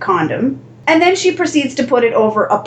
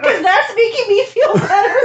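laughing mock outrage; 9 semitones higher than usual.

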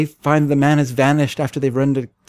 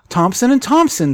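high, slow, surprised exclamation; 9 semitones higher than usual. Acted. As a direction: gasp the names out in shock. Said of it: "as if he's surprised".

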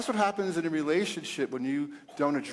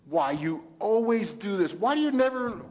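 accusing complaint, rising much higher; mock accusation; 5 semitones higher than usual. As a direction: act out an exasperated nag. Example of accusing a partner.

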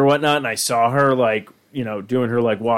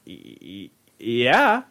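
nervous stammer; 5 semitones lower, 4 dB quieter, much slower than usual. Retold or imitated. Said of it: "I'm just like".

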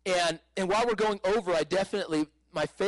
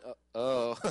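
dismayed nurse reaction; 6 semitones lower than usual. Retold or imitated. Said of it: "that was her reaction".

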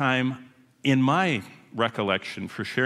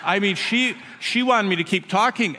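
ordinary talk

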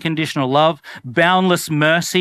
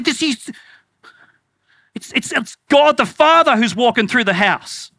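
higher, flustered stammering worker; mock panic; 5 semitones higher than usual. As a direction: play a staff member panicking as God walks in. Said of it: "workers of the house freaking out".

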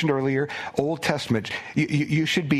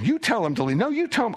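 mock quarrel, the second line much higher; bickering disciples; 9 semitones higher than usual. Disciples squabbling over who sends him away, mock bickering. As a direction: squabble like disciples shoving the job off. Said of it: "if they're saying".

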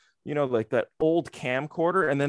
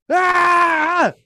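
piercing shriek, much higher and louder; sudden high shriek; 9 dB louder than usual. Retold or imitated. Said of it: "someone just went".

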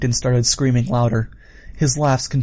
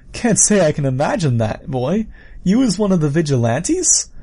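higher, questioning villain voice; amused villain; 5 semitones higher than usual. Acted. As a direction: needle them with a rising question.

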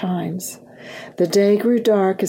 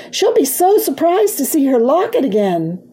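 put-on high, eager child's excitement; exclaiming; 6 semitones higher, 4 dB louder than usual. A narrator's reading. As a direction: gush with happy anticipation. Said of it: "exclaimed Leah".

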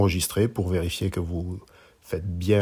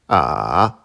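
A dipping low then climbing back up; third tone on A; 4 semitones lower, 6 dB louder than usual. Shown as a demonstration. Teacher showing learners Mandarin A, dipping tone. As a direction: draw A down and up again. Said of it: "Une dernière fois".

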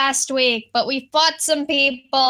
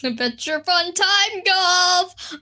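high, slow gleeful announcement; excited; 6 semitones higher, much slower than usual. Real, not acted. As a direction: savour the name with delight. Echoes sponsor name enthusiastically.

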